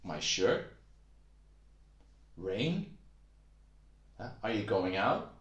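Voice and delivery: "shirt", "Rain" and "out" each swept upward; questioning rise. Shown as a demonstration. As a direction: end each short question on a clear rise. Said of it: "Your voice goes up a bit".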